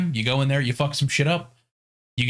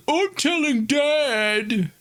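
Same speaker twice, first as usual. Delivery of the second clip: very high, slow tattling whine; whiny kid; 13 semitones higher, much slower than usual. Retold or imitated. Thor's son tattling after his brother dies, whiny. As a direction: drag it out like a bratty child. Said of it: "the other one's like".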